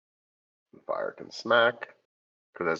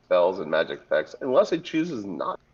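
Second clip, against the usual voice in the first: usual speaking voice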